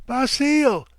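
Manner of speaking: high piercing shriek of the name. A narrator's reading. Wife shrieking for her husband in the dark, terrified scream. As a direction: scream his name in panic. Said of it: "she shrieked".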